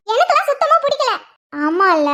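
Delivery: high-pitched, vehement protest; indignant refusal. Acted. Girl rejecting the arranged match to her sister, indignant and high. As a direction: reject it outright, voice jumping up.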